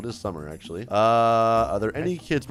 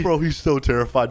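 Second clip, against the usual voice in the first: usual speaking voice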